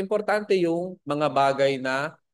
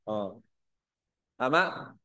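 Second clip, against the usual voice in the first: ordinary delivery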